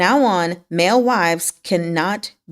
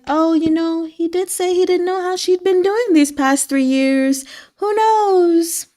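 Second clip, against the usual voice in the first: high, coy, innocent shrug of a voice; feigned innocence; 10 semitones higher than usual. Retold or imitated. Qing Yu steering the crown prince, in a high coy innocent voice. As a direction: play dumb sweetly, pitched up. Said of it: "he's just like".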